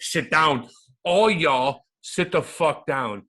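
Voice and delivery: high, loud bossy command; retold shouted order. Guest re-enacts the stands, shouting everyone down. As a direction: order the whole row to sit, loudly. Acts shouting at people around him.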